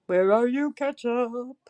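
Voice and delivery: drawn-out searching call; playful, lilting call; singsong calling. Real, not acted. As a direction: call as if playing hide-and-seek. Calling out for Ketchup.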